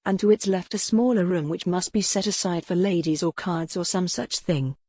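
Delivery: plain reading voice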